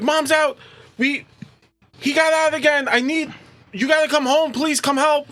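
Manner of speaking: put-on high, urgent pleading girl's voice; upset teenage girl. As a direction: beg her dad to come home. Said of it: "she's like".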